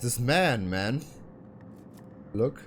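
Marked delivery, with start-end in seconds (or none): none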